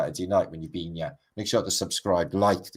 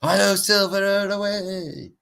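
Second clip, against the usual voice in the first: high, slow, drawn-out cry of the intro; hammed-up Lone Ranger rallying cry; TV intro cowboy call; 11 semitones higher, 4 dB louder, slower than usual. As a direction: sing it out like the old Western intro. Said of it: "You'll see".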